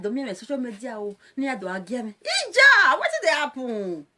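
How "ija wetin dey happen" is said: high, loud cry of Ija; loud Ija call to viewers; loud exclamation. Real, not acted.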